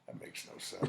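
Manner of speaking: unvoiced mock whisper; hushed, breathy aside; whispered quote. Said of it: "you're not like".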